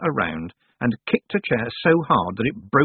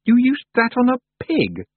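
shocked; 6 dB louder than usual. A narrator's reading. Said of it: "said Tiffany, shocked".

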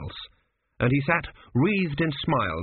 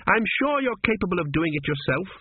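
sour; 7 semitones higher than usual. Acted. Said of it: "she added sourly".

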